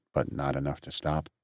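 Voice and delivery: plain reading voice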